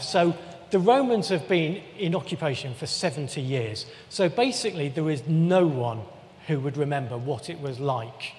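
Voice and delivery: ordinary talk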